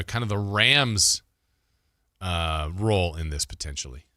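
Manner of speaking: normal talking voice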